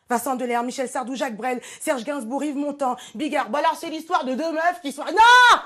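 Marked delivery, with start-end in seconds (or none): 5.0-5.6 high, loud sudden scream; panicked shout. Acted. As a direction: shriek it in comic panic. Comic panic outburst. (cri aigu et fort de panique)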